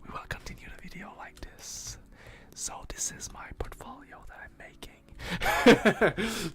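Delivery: joking ASMR whisper; breathy mock ASMR whisper to the stream. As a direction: whisper it like a teasing ASMR video. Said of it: "it's not an A S M R video".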